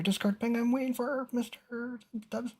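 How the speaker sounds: real voice crack up high mid-question; voice breaking. Real, not acted. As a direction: voice cracks up high and then drops to soft. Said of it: "My voice really broke there".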